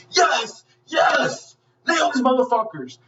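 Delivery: high-pitched, elated cheer; whooping fan yelling at the screen; excited cheering. Retold or imitated.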